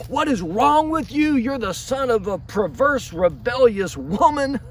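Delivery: enraged king berating his son Jonathan, pitched high; furious king. Acted. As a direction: let the temper blow up high. Saul loses his temper at son.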